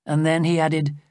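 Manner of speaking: ordinary delivery, nothing marked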